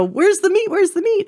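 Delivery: mock yelling. Part of her brain demanding meat, mock yelling. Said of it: "screaming, yelling, going".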